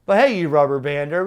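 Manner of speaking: ordinary talk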